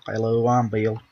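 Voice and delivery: drawling hillbilly accent; Bill. Shown as a demonstration. Demonstrating the manga hillbilly to a friend, hillbilly accent. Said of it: "the hillbilly accent".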